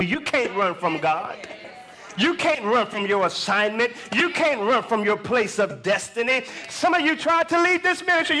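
forceful preacher, climbing high; full-force preaching. Real, not acted. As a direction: drive it home to the congregation, rising in pitch.

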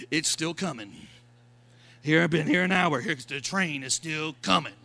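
low, mock-awed bystander voice; lightly voiced awed crowd; awed onlooker. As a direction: gawk at the king's long train. People watching king's train.

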